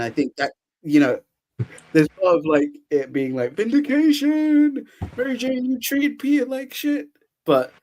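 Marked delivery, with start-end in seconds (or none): 3.5-7.1 triumphant cry. Retold or imitated. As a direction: stretch it out like a victory shout. Voicing Peter's vindicated feelings.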